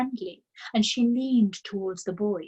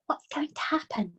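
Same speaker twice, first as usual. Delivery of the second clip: unvoiced, frightened schoolgirl whisper; 8 semitones higher than usual. A narrator's reading. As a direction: breathe the question without voice. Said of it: "Lavender whispered".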